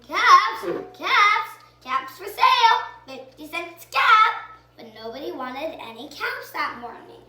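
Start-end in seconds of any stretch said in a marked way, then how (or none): 0.1-4.3 loud peddler's street cry; calling out wares. A narrator's reading. Peddler hawking caps in the street, loud sing-song call. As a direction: sing out to sell caps to passersby. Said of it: "the street calling".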